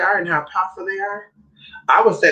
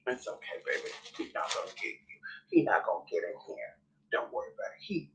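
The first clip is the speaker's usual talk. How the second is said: whispered comfort to a scared pet; hush, soothe the little one; soft reassuring whisper; 7 semitones lower, 16 dB quieter, faster than usual. Real, not acted. Whispering comfort to her frightened dog.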